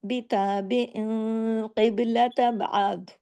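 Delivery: slow, drawn-out tajweed chant; Quranic recitation. Shown as a demonstration. Teacher reciting a verse for students, slow chant. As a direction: recite it slowly with full tajweed.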